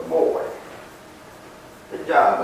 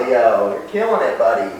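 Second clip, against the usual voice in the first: ordinary talk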